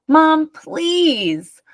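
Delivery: put-on high child's whine; whining. A narrator's reading. Marta begging Mom to keep the chair, whining. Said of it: "whined Mar-, Marta".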